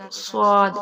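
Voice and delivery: صاد thick and forceful; heavy hard saad. Shown as a demonstration. Teacher demonstrating the hard, heavy صاد. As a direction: press صاد out heavily. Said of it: "صاد کو موٹا پڑھیں گے اور سختی کے ساتھ".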